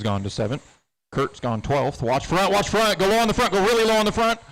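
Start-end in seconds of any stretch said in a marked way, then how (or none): 2.0-4.4 rising urgent calls, higher; race spotter radio. Real, not acted. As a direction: build the warning up fast. Spotter warning driver urgently.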